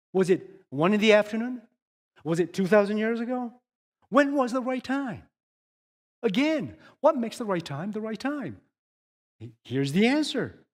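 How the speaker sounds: rising pulpit intensity; fiery preaching. Acted. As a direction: preach it to the back row. Preaching at full force.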